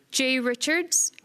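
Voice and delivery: ordinary talk, nothing marked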